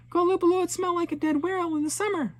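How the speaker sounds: high, loud mocking yell across the ice; yelled taunt. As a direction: shout it to provoke the monsters. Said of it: "Alishua yelled".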